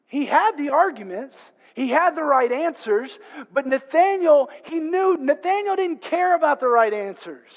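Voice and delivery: loud, high, impassioned sermon delivery; full-force preaching. Real, not acted. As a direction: drive each point home at full voice.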